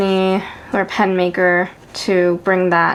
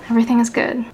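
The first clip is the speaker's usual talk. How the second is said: whispered, mock cheerful; sarcastic whisper; much faster than usual.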